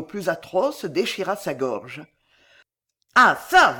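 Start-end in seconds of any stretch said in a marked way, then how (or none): 3.2-3.8 loud, high angry outburst; vocifération. A narrator's reading. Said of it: "vociféra Belgodère". (vocifération furieuse)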